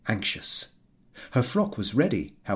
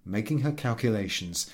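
plain reading voice

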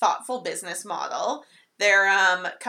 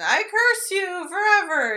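high, loud, drawn-out mock curse; cursing leprechaun; 10 semitones higher, 5 dB louder than usual. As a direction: imitate a leprechaun hurling a slow curse. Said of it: "Yeah, he curses him".

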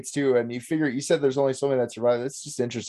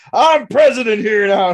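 pompous self-appointed president; 6 semitones higher, 8 dB louder than usual. Retold or imitated. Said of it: "just like".

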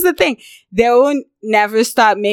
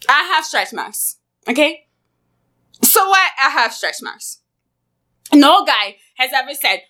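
usual speaking voice

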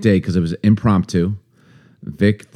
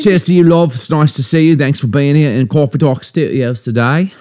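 higher-pitched, hammed-up British accent; mock British accent; 6 semitones higher than usual. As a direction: play at being British for a toast. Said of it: "talk to each other in British accents".

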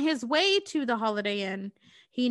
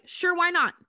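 naive eager voice; 8 semitones higher, 4 dB louder than usual. Retold or imitated. Naive Brendan agrees again.

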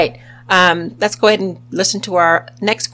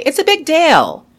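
imitating a British accent on "it's a big deal", stress on "big"; 8 semitones higher, 4 dB louder than usual. Podcast host mimics the British saying it, mock British accent. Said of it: "Or as the British would say".

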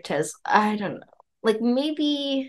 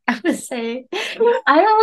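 laughing; 7 semitones higher, slower than usual. Real, not acted. Laughing whisper start.

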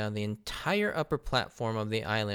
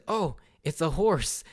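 imitating Luffy; 6 semitones higher, 3 dB louder, slower than usual. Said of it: "Luffy says".

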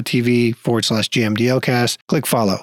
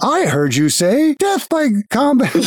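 much higher mock-robot voice; smug automaton; 10 semitones higher than usual. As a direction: twist their answer like a glitchy machine. Automaton mishears on purpose.

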